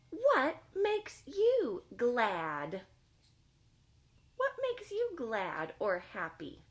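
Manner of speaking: lightly voiced friendly monster, slow; monster voice asking. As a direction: ask slowly like a cheerful monster.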